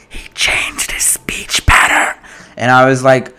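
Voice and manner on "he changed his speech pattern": slow, forceful whisper, no voice; excited stage whisper. Retold or imitated. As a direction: whisper an amazed discovery to the person beside you. Re-enacts whispering to companion during film.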